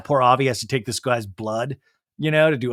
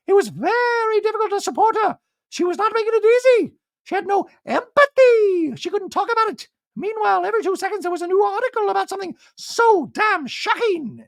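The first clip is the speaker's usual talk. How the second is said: very high, loud, squeaky voice; Dorit impression; 4 dB louder than usual. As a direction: squeak it like a posh socialite.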